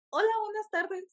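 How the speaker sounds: retold high-pitched hello, sheepishly polite; startled polite greeting. Saludo retomado, sorprendida ante la suegra. (saludo agudo y cortado de sorpresa)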